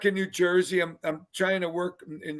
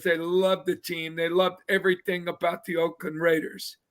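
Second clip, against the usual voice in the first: usual speaking voice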